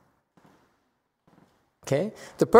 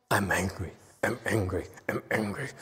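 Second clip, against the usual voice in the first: low, much softer repeated chant; muttered mantra; 7 semitones lower, 11 dB quieter than usual. Shown as a demonstration. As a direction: mutter it low to yourself, over and over. Acting out single-minded focus on anger.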